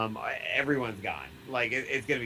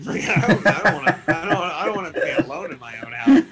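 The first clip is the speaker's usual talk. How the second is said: louder, higher, laughing through the words; 5 semitones higher, 11 dB louder, faster than usual. Real, not acted. Admitting to cohost he hates being home alone, laughing. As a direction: confess it while cracking up.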